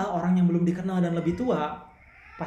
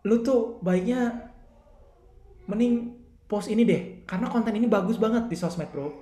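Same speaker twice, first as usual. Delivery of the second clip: casual bro tone; 4 semitones higher than usual. Shown as a demonstration. Friend giving a buddy advice about posting, in a relaxed bro tone. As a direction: talk to him like an old mate, relaxed. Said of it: "udah ada bronya nih".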